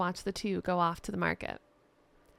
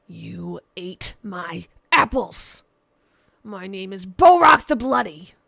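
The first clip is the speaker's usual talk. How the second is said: slow, booming brute; Borak's enraged roar; 12 dB louder, slower than usual. Acted. Borak accusing Jester of eating his apples, roaring. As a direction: hurl the name out like a threat.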